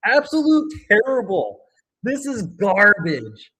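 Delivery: angry rant. Real, not acted. Ranting at the referees.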